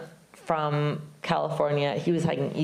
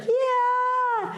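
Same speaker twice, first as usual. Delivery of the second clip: shouted reply. Retold or imitated. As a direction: whoop back from far down the road. Bernie shouts back from afar.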